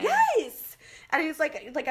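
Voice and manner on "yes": sudden high, loud burst of agreement; squealed emphatic yes; excited yes. Real, not acted. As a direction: jump in with a fired-up yes. Emphatic agreement mid-story.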